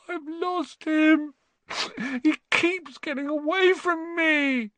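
very high, drawn-out wail; hammed-up tearful boy's lament. Boy who has lost his toad, wailing. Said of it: "he wailed".

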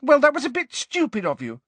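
high, loud, angry rebuke. A narrator's reading. Ginny rebuking Harry for shutting everyone out, angrily. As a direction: lash out angrily, pitch right up. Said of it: "said Ginny angrily".

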